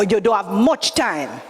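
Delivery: usual speaking voice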